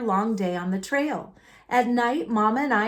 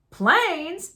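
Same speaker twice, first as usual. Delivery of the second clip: scoffing; 7 semitones higher, 3 dB louder than usual. A narrator's reading. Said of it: "scoffed someone else".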